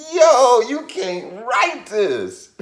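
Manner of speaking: high, loud, drawn-out exclamation; amazed disbelief. Retold or imitated. Amazed at the same-floor coincidence, loud disbelief. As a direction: stretch it out in sheer amazement.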